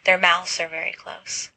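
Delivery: usual speaking voice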